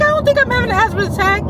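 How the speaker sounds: brushing off the doctor, fast and high; fast high denial. Retold or imitated. To her doctor, denying the asthma attack: fast high protest. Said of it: "to me, I'm like".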